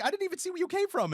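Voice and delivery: very high, rushed mock woman's voice; fast high-pitched lady imitation; imitated lady. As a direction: blurt it fast as a flustered lady. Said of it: "she was just like".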